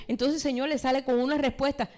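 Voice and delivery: ordinary talk, nothing marked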